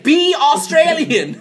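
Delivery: loud, high, slow demand with laughter; mock exasperated order. Real, not acted. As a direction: stretch it out as a laughing command. Mock order to sound Australian.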